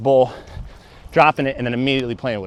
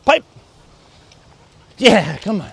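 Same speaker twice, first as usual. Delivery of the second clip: excited dog praise; 8 semitones higher, 6 dB louder, slower than usual. Real, not acted.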